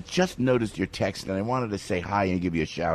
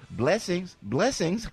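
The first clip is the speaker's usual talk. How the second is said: high-pitched mock Southern goodbye; imitated Southern accent; 8 semitones higher, much slower than usual. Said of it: "when they say goodbye to you".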